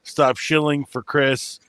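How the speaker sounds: usual speaking voice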